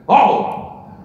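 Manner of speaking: loud, put-on veteran actor voice; Al Pacino. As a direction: burst in as an old, possibly drunk star. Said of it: "He comes up to me and he goes".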